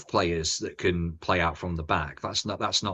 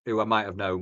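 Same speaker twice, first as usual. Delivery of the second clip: ordinary talk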